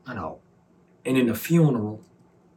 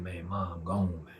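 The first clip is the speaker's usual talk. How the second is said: breaking bad news; 4 semitones lower, 8 dB quieter, slower than usual. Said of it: "Say that again".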